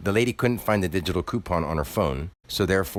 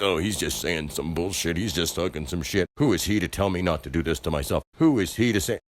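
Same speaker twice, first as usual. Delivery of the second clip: imitating scoffing people; 4 semitones lower than usual. Mocking how strangers dismiss his advice, low scoffing. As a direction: lightly voice the doubters brushing him off. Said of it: "thinking like".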